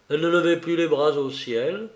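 usual speaking voice

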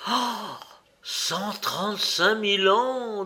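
high, amused gasp of disbelief; incredulous echo. A narrator's reading. Indian doubting the Egyptian's boast, incredulous. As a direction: draw out the number in mocking astonishment.

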